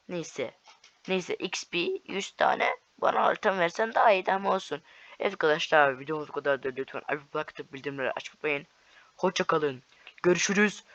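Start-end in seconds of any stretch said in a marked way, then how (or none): none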